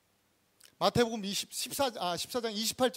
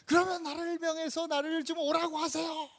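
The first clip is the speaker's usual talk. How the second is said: raised, sustained high pitch; calling out; 7 semitones higher, 3 dB quieter than usual. Acted. Peter asking Jesus to call him over, high-pitched call. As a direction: call the plea out on a high note. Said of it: "막 이러면서".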